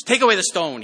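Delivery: loud ringing order; command. Acted. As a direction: call it out over the crowd. Jesus commands at the tomb.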